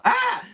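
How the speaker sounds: high, loud mock scream; sudden shrieked "ah"; startled yelp. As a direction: yelp as if something landed on you. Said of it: "and they go".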